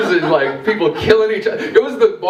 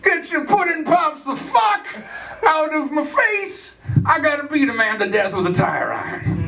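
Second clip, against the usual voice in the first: Bill Cosby voice; 10 semitones higher than usual. Acted. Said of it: "see Bill Cosby just like".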